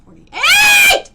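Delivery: sudden high, loud cry of the number; shout. Real, not acted. Healer shouting out the age she found. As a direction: blurt it out like a find.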